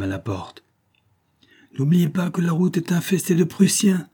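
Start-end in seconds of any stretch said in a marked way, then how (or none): 1.8-4.1 high-pitched warning call. A narrator's reading. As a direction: call out a reminder, pitch raised throughout. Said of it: "me cria-t-il".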